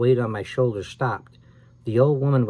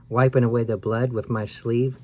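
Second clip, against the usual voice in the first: usual speaking voice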